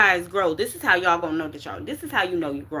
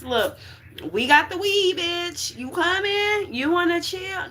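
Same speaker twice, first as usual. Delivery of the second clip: rising to a much higher, coaxing party-friend voice; tempting voice; 8 semitones higher than usual. Acted. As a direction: coax them like a friend waving them over to party. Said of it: "to tell you".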